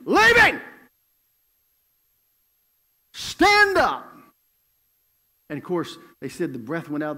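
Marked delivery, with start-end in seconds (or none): 0.0-4.1 king's booming shout at the guards. Acted. King shouting at his guards to release the man, booming shout. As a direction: bellow the order from the throne. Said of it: "he shouted to the men".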